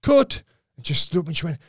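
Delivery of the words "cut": high, loud call of cut. Retold or imitated. Director stopping the take, loud call. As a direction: call it out across the set.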